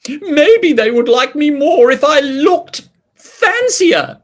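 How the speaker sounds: a vain king wondering aloud; King Pig. Acted. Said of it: "said King Pig".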